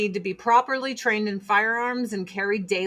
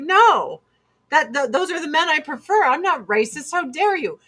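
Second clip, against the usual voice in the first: mock indignation; 6 semitones higher, 3 dB louder than usual. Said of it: "He's like".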